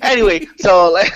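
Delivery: breaking into a loud laugh; giggling through anyway. Real, not acted. Host losing it after co-host's intro, high loud laughter.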